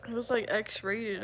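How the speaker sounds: normal talking voice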